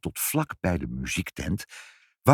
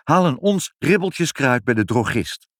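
raised, higher voice; calling out; 7 semitones higher, 4 dB louder than usual. A narrator's reading. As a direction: call out an urgent errand. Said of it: "riep vader". (roepend, met verheven stem)

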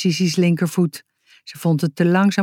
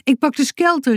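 high, urgent announcement; excited raised-pitch call; 8 semitones higher than usual. A narrator's reading. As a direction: call it out hurriedly. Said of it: "riep Boutje".

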